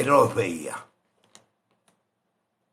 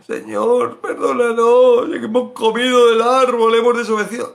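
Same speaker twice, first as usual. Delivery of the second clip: pleading Adam; 9 semitones higher, 8 dB louder than usual. Pretend. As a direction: beg the Lord for pardon. Voicing an imagined repentant Adam. (Adán suplicando perdón, alto y fuerte)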